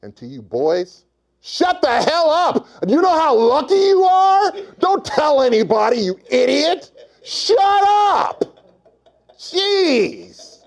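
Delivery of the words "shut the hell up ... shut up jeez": high, hammed-up outraged yell; mock exasperated scolding. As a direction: yell it like they're blowing a lucky secret. Mock rant scolding lucky boys.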